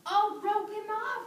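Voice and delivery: excited suggestion. Real, not acted. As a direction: relish the idea. Teacher voices excited idea.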